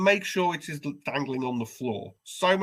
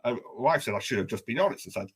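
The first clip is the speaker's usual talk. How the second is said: ordinary delivery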